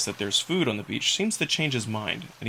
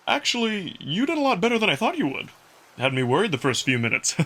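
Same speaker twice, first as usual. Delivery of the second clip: Amicus the wolf, a touch higher and brighter-timbred, easy and friendly; 7 semitones higher than usual. Acted. Wolf Amicus catching his breath after the race, playful role voice.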